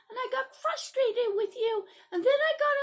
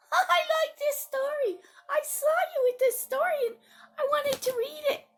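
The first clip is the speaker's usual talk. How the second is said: put-on high, quick, louder child; defensive young friend; 3 semitones higher, 7 dB louder than usual. Friend called over about the book, defensive. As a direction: answer hastily in a high kid voice.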